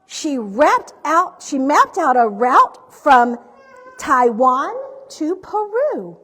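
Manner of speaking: projected, rhyming picture-book narration; story reading to children. A narrator's reading. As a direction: read the rhymes out with big, deliberate emphasis.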